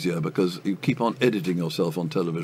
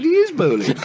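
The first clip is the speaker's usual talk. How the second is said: much higher, louder, stiff correcting voice; indignant chap; 5 dB louder than usual. Retold or imitated. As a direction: snap back a correction, high and sharp. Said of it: "The chap said".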